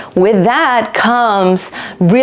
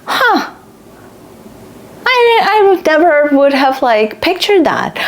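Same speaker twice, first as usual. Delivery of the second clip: surprised; 7 semitones higher than usual. Retold or imitated. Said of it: "And I'm like".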